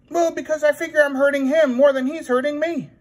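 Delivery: very high silly princess puppet. Acted. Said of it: "You know that sounds crazy".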